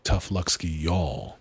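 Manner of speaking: a light American Southern accent on fake Russian; mock Southern Russian. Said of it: "what they say in Southern Russian".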